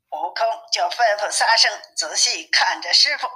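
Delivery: usual speaking voice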